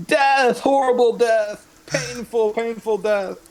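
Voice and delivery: high, ham dying wails; hammed-up melting death. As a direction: overact a painful death. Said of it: "he's gonna act like he's melting".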